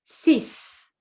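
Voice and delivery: six ending in a hissed S; six, final X said as S. Shown as a demonstration. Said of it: "Can you hear that?".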